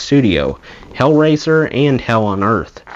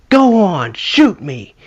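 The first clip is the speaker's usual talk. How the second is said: high, defiant mock shout; Mickey; 8 semitones higher, slower than usual. Bandmate daring a cop with a pistol, high defiant cry. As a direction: imitate a reckless drunk daring them, pitched up. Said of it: "Mickey was tearing open his shirt, going".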